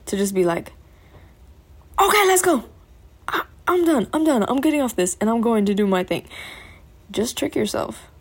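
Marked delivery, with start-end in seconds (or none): 2.0-6.3 hyped self-pep talk to quit TikTok; sudden hype. Pretend. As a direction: snap out of the scroll, fired up. Acting sudden burst of motivation.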